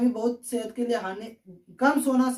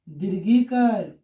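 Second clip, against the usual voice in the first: slow, drawn out; 4 semitones lower, much slower than usual. Shown as a demonstration. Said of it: "इसे क्या पढ़ते हैं बच्चों".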